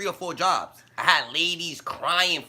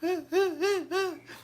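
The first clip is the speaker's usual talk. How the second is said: laughing cry; 11 semitones higher than usual. Real, not acted. Laughing at crying ladies.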